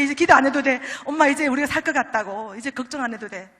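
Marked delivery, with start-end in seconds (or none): 0.0-2.5 loud, thrilled disbelief; overjoyed retold letter from the daughter; excited daughter. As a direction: burst out with the amazing news.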